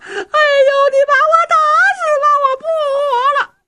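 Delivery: very high, loud crying wife; hammed-up wailing. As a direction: wail theatrically under the blows. Beaten wife wails. (尖声哭嚎撒泼)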